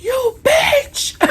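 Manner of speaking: very high, loud shriek; mock hysterical scream at the neighbor; imitated screaming neighbor. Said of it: "And she's like".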